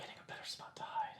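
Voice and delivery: hushed, barely voiced reply; furtive whisper. Explaining a move to a hiding spot, furtive whisper. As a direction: whisper it while sneaking into hiding.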